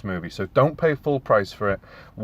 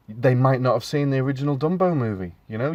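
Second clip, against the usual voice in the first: usual speaking voice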